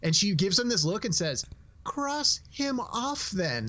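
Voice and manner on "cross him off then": slow, high, offhand "Cross him off then"; mock bored owner, slow and dry; deadpan dismissal. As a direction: brush it off like it's obvious. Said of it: "the way she delivers that".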